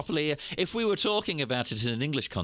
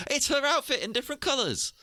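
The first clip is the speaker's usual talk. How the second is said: pitched way up, sneering at the lazy costume idea; mocking dismissive quote; 14 semitones higher than usual. Mocking the costume designers with a high, sneering scoff. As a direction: scoff like it's a cheap copy. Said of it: "without just being like".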